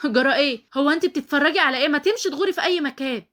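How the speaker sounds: high, loud outraged shouting; shrieked, exasperated telling-off; enraged yelling. Acted. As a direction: lash out at an onlooker in fury. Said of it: "بتزعق لؤى من غيظها".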